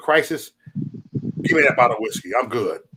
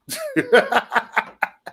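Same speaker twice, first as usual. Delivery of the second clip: a burst of high-pitched laughter; high cackling laugh. Real, not acted. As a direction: laugh high and loose at your own joke. Laughing at the beef.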